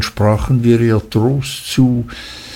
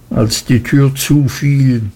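ordinary delivery

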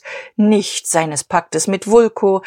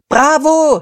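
"Bravo" yelled much higher and louder; shouted cheer; 10 semitones higher, 6 dB louder than usual. Acted. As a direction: cheer it like a roaring crowd. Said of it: "schrien die Lieferanten". (lauter Bravoruf)

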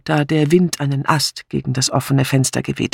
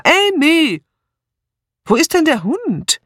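calling out; 13 semitones higher than usual. A narrator's reading. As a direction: shout the name, then wonder aloud. Searching for Amy and dog. (suchend laut gerufen)

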